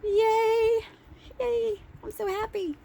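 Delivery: high, squealing mock glee; giddy cheering. Shopper finding Diet Doctor Pepper on the shelf, squealing with high mock joy. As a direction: squeal it like a thrilled kid. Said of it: "So I was like".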